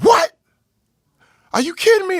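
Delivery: high-pitched, incredulous outburst; loud, high retold outrage; outraged disbelief. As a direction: explode in disbelief, voice leaping up. Reacting to aunt's alarming call.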